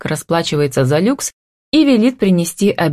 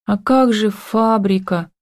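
slow, higher-pitched plaintive question; drawn out; 6 semitones higher, slower than usual. A narrator's reading. Tanya worrying about her factory, drawling the question. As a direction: stretch the words out, pitched up. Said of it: "протянула Таня".

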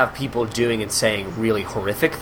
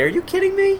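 high exasperated question; mock incredulous; faster than usual. Setting Alex straight on the buffet, high and incredulous. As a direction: shoot up high in hammed-up disbelief.

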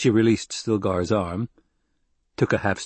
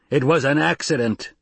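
raised roar; 6 semitones higher, 4 dB louder than usual. Acted. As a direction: bellow the denial. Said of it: "Jameis roared".

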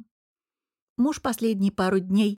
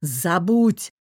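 mildly roared angry command; roaring; 4 dB louder than usual. A narrator's reading. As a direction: bellow it, holding back a little. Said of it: "продолжал реветь Богдан".

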